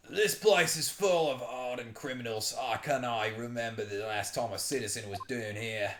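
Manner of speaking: put-on Australian accent for a prison governor. Governor complaining about hardened criminals, mock Australian accent. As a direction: play him as an Australian. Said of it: "I'm just making him an Australian".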